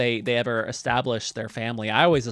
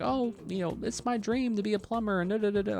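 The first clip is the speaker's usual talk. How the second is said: high, softer imitation of pleading sons; mock earnest dreamers; 8 semitones higher, 5 dB quieter than usual. Brothers defending their plumbing dream to parents, mock earnest. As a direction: parody a wistful dream speech. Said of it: "And they're like".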